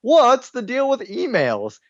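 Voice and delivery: high, slow standup observational whine; Jerry Seinfeld. Retold or imitated. Host rambling to the intern about his emails, Seinfeld-style high slow delivery. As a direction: pitch it up and stretch it like a sitcom comic's bit. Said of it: "pulling, like, a Jerry Seinfeld thing".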